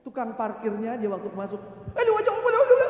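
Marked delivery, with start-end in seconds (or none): 1.9-2.9 very high, loud mock shouting; hammed-up rapid-fire yelling; imitated parking attendant. Imitates parking attendant speaking Batak.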